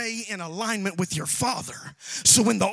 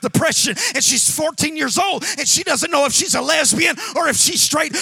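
loud, rising preacher cry, building to the peak; preaching at full force; 5 semitones higher, 6 dB louder than usual. Real, not acted. Pastor urging the church to get planted, full-force preaching. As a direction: drive it home to the congregation.

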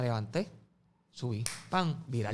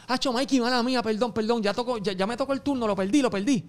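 panicked apology; 8 semitones higher, 4 dB louder than usual. Retold or imitated. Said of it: "Y me dice".

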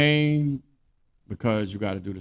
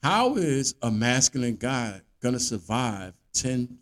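ordinary talk, nothing marked

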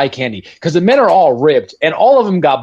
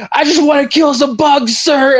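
higher, fired-up grunt voice; pumped soldier yelling to his officer; gung-ho soldier; 10 semitones higher than usual. Retold or imitated. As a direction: play the eager trooper itching to fight. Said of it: "Watkins quote, dying soldier".

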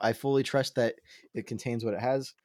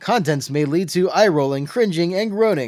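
loud, higher mock warning announcement; announcer read; 6 semitones higher, 8 dB louder than usual. Co-host reads Dad Jokes book warning aloud, loud announcer style. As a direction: belt out the label like a showy announcer.

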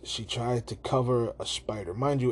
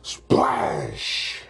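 loud, noisy burst of a splash; exaggerated splash effect; spoken sound effect; 9 semitones lower, 6 dB louder than usual. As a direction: burst the word out like crashing water. Voices sound effect of water.

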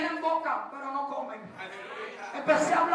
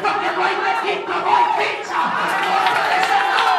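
loud shouted preaching with rising pitch; full-force preaching; 3 semitones higher, 8 dB louder than usual. Real, not acted. Preacher shouting the commander's words to the church, full force. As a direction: preach it hard, shouting to the congregation.

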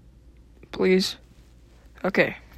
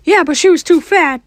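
Mickey Mouse voice; 8 semitones higher, 6 dB louder than usual. Acted. Character answers host.

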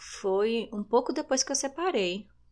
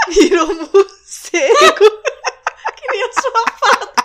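laughing hard; 14 dB louder than usual. Real, not acted. Friends laughing at tattoo turned bat.

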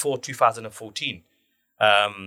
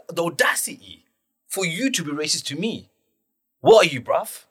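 confrontational outrage; 6 semitones higher than usual. Retold or imitated. Caller confronting a stranger who mocked their language, indignant. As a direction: square up to them, voice raised in pitch. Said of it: "I went to that mode".

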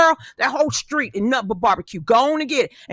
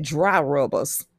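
teasing repeat; 9 semitones lower, much slower than usual. Real, not acted. Mock repeating the co-host's slip.

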